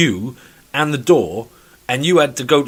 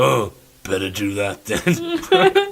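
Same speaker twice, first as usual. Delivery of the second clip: mock resigned; 4 semitones lower, much slower than usual. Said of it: "I go".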